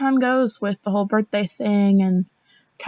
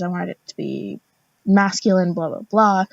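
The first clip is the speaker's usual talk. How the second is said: ordinary talk, nothing marked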